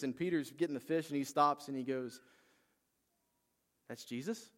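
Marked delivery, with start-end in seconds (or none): 3.9-4.5 rising, wondering question; questioning disbelief. Retold or imitated. Peter stops hauling fish, questioning in disbelief. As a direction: ask it as if it is just sinking in.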